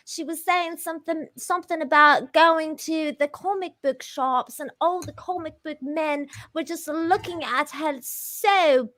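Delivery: put-on upper-class British accent. Shown as a demonstration. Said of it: "try and do a British accent".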